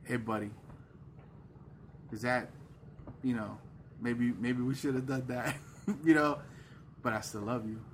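softly, a kind friend's quiet nudge; gentle nudge. Retold or imitated. Holy Spirit gently coaxing a believer, soft and kind. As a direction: ease off and coax gently, no blame. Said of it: "the Holy Spirit ... is like".

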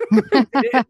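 high laugh breaking the word. Real, not acted. Laughing as she admits not knowing, giggling.

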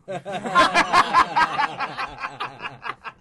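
a long, high, loud laugh; loud burst of laughter. Real, not acted. Big laugh at joke.

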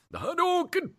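high, loud fighting-game cry; yelled game move. Acted. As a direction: bellow it like a special attack. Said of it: "yelling the move you're doing".

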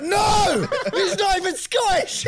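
high, loud yell through laughter; mock outraged shout. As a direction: shout it in hammed-up disbelief. Acted outrage at imagined Fergus moment.

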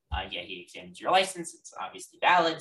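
usual speaking voice

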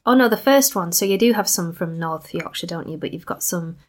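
ordinary talk, nothing marked

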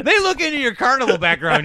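high, loud hammed-up counselor; mock guidance counselor. Counselor warning student about college admissions, hammed-up. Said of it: "talking to the guidance counselor".

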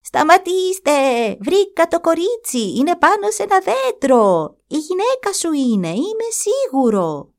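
put-on the young calf calling the herd to stop, high shout. A narrator's reading. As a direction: yell after the distant herd. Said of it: "βάλθηκε να φωνάζει".

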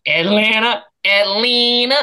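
drawled Southern Atlanta. Shown as a demonstration. Host shows friends how locals say Atlanta, Southern drawl. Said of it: "show 'em how it's done".

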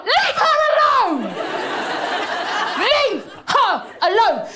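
high, drawn-out mock shout; comically impassioned plea on her behalf; protective outburst. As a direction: yell it slowly, hitting each word. Acted outburst defending Michelle Obama.

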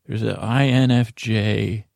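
ordinary talk, nothing marked

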